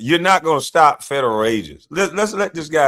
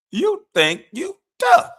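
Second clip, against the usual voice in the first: much higher, slow sing-song taunt; high mocking taunt; slower than usual. Real, not acted. Mocking the governor's tough talk.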